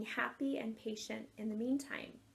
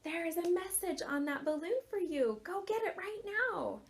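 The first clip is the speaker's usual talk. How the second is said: inner voice; 6 semitones higher than usual. Retold or imitated. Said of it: "voice in my mind that just said".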